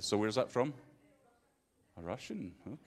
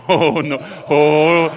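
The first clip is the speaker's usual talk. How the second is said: usual speaking voice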